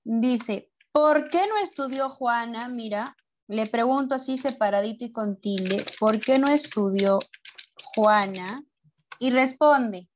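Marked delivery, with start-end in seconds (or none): 6.0-8.6 slow dictation. Shown as a demonstration. Teacher repeats the question for pupils, in dictation pieces. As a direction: question broken up with a pause before Juana.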